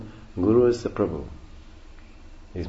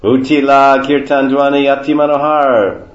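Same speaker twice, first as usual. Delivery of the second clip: loud, higher chanted verse; verse recitation; 4 semitones higher, 8 dB louder than usual. Real, not acted. Teacher reciting the kirtan verse to listeners, loud chant. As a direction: declaim the scripture line in a sustained tone.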